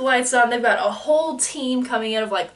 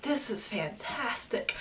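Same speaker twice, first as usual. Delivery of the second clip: relieved; 11 dB quieter, slower than usual. Retold or imitated. Retold relief, quiet slow.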